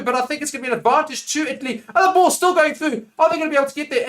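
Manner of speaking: rising commentator excitement; excited play-by-play. Real, not acted. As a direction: call the break as it unfolds, voice climbing then easing. Live play-by-play at full tilt.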